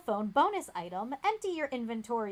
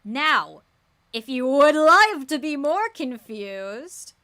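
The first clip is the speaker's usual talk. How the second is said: loud, slow showman offer; grand mock offer; 4 semitones higher, 9 dB louder, slower than usual. Real, not acted. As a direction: offer it like a game show host.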